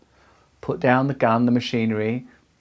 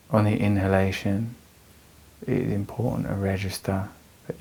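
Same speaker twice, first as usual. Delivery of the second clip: measured meditation voice with long pauses; guided meditation. Real, not acted. Teacher guiding anxious listeners through breathing, calm meditation voice. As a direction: guide them calmly through the breath, leaving space between phrases.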